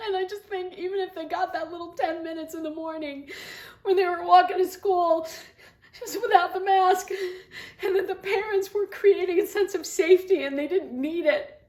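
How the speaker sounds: real tears breaking through the plea; tearful plea. Real, not acted. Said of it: "Sorry, I'm getting emotional".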